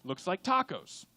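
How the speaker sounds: ordinary delivery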